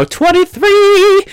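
a cracked high squeal; high squeaky aside. Real, not acted. Vlogger squeaks a joke about his age.

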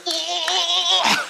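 long, high mock scream; imitated demonic wail. Possessed man reacts to the prayer, high mock wail.

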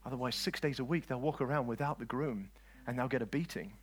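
ordinary talk, nothing marked

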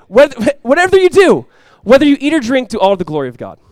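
full-force preached verse; preaching the verse. Acted. Repeats verse, laughing, full force.

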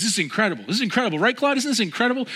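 high-pitched imitation of an excited showman; excited amazement. Man marvels to his new friend Claude, excited. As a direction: gush in mock astonishment. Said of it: "very demonstrative person and super entertaining".

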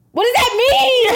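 high, loud, incredulous outburst; exasperated demand. Acted. Acted quarrel with boyfriend.

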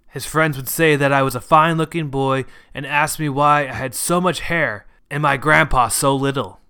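ordinary delivery, nothing marked

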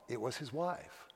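ordinary talk, nothing marked

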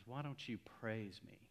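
very soft, gentle inner voice; hushed imitation of a tiny voice; still small voice. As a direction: barely voice it, like a quiet nudge from God. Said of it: "a still small voice".

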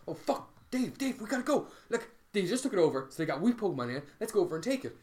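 retold panic, rousing a mate into action; urgent alarm. Player calling friend to retake the gym, urgent alarm.

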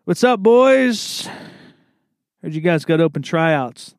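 swagger in like the new star, drawn out; hammed-up cocky walk-on greeting.

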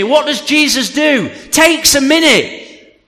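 high, driving sermon delivery; forceful preaching. Real, not acted. Preacher telling the church of Peter sinking, forceful. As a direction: preach it hard and urgently.

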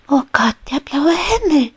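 high, loud jubilant cry. Acted. Little bee Maya cheers at the blue sky, high and jubilant. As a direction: burst out with joy at the sky. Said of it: "jubelte Maya".

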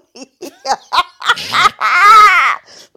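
loud, high burst of laughing; loud laughter. Real, not acted. Friend cracking up at her co-host's joke, loud laughter.

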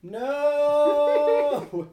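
loud, high, long-drawn no; dismayed cry. Real, not acted. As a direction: wail it out in protest. Reacts to the natural one.

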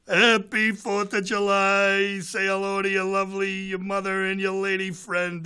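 a hint of the mayor's cheerful voicemail; mayor's birthday greeting. Mayor leaves a holiday voicemail for Joe, mild cheerful voice. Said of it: "in the video he goes".

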